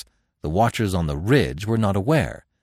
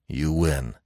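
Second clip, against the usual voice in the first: grunted; 7 semitones lower, 4 dB quieter than usual. Acted. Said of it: "he grunted".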